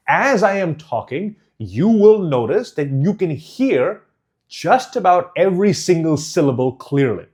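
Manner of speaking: every syllable of "As I am talking" sounded out clearly; over-enunciate each syllable, down to "single syllable clearly"; overly pronounced. Shown as a demonstration. Coach showing clear speech, "every single syllable" overly pronounced. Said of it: "I am overly pronouncing it".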